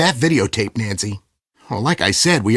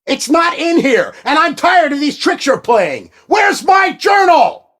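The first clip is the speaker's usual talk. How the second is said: higher, louder, slow final roar on the question; angry shout; 13 semitones higher, 4 dB louder than usual. Acted. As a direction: explode at her. Villain raging over missing journal.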